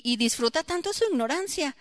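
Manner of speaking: ordinary talk, nothing marked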